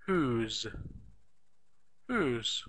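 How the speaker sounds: whose said as one short huz; contraction whose. Shown as a demonstration. Teacher models the contraction whose. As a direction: squeeze who is into whose. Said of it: "we could use a contraction".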